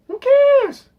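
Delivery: high, very loud outburst; exasperated yell. Real, not acted. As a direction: explode in dismissive disbelief. Exasperated rant about the film.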